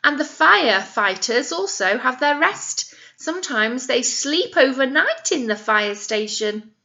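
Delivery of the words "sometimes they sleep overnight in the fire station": usual speaking voice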